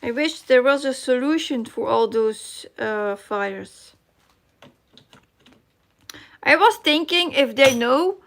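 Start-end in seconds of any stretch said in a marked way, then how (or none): none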